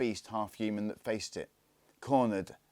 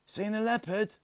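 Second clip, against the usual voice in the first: higher, lightly voiced boy asking; a pitched-up kid's whisper-free question; boy's eager question; 14 semitones higher than usual. A narrator's reading. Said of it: "asked the Indian".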